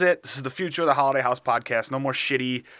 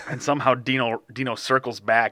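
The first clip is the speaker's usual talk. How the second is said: usual speaking voice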